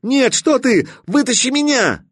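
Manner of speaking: very high desperate pleading cry; panicked begging. Acted. Stalker begs Katya to pull him out, panicked. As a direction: beg for your life.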